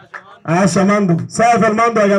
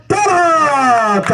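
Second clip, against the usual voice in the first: name stretched out very slowly; drawn-out name call; 4 semitones higher, much slower than usual. Real, not acted. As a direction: hold the name long for the crowd. Announcer stretching out a winner's name.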